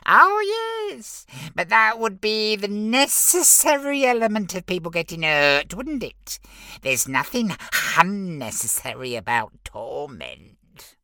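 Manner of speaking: very high smug little imp; giggly sneering imp. Acted. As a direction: squeak it out smugly. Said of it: "the lead imp explained".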